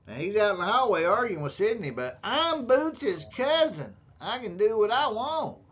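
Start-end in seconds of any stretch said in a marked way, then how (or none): 1.9-5.6 higher, drawn-out mimicking of a cocky cousin; mock entitlement. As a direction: imitate him insisting, pitched up. Mimics cousin arguing in hallway.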